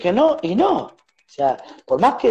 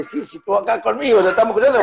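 mock cocky tone; 4 semitones higher than usual. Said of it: "no podía creer cómo me hablaba".

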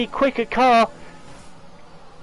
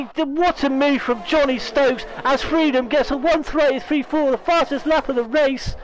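ordinary talk, nothing marked

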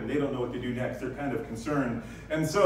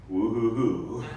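half-hearted woo-hoo; 3 semitones lower, slower than usual. Retold or imitated. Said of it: "like some of you guys".

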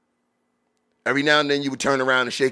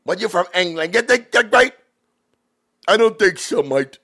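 high mock English accent, hammed-up; horrible English accent; 8 semitones higher, 4 dB louder than usual. Host mimicking a rapper's clip, horrible English accent. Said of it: "horrible English accent".

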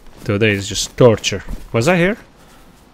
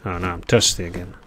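ordinary talk

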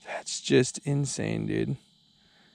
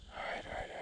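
whispered, tense and focused; 21 dB quieter, faster than usual. Real, not acted. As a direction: breathe the words out while landing it.

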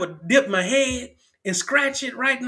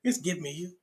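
quietly, almost to himself; the song line murmured softly, no longer sung; soft hushed reprise; 4 semitones lower, 7 dB quieter than usual. Acted.

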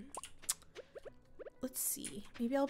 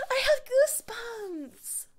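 high, drawn-out tearful excitement; real tearful awe, high and slow; teary; 10 semitones higher, 5 dB louder, slower than usual. Real, not acted. Player overcome at finishing the community center, teary. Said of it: "I'm a little teary".

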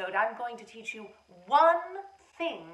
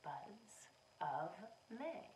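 whispered echo; 5 semitones lower, 22 dB quieter, slower than usual. Shown as a demonstration. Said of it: "Repeat after me".

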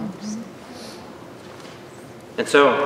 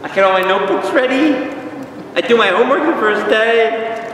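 eager schoolkid; 7 semitones higher than usual. Acted. Acting out keen first day pupil.

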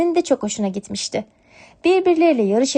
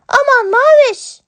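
much higher, louder, slow alarmed kitten shout; alarmed kitten cry; 7 dB louder than usual. Acted. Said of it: "diye bağırdı". (yavru kedi, telaşla bağırarak)

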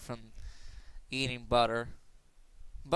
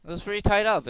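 raised, louder; louder and higher than the next take; louder first take; 6 semitones higher, 6 dB louder, faster than usual. Shown as a demonstration. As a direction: say it up high and loud. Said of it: "repeated softer".

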